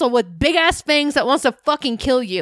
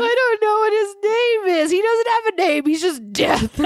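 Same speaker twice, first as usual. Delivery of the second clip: high wail dissolving into laughter; real helpless laughter under the words; cracking up; 7 semitones higher than usual. Real, not acted. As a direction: protest while losing it laughing. Cracking up, cannot name the man.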